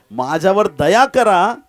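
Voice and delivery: loud, slow cry for mercy; pleading cry. Acted. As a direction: call out loudly, stretching the plea. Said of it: "दया शब्दात सगळंच आलं". (दयेची मोठ्याने मारलेली हाक)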